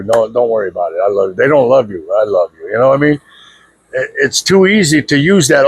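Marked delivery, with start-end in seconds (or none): 0.0-2.7 a lower, coaxing mock of a smothering comforter; mock enabler. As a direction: croon it like someone who only pats you on the back. Mocking enablers who coddle people.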